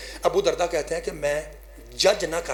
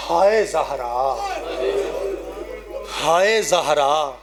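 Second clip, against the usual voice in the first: slow lament; much slower than usual. Acted. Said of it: "یوں علی کر کے کہتے ہیں".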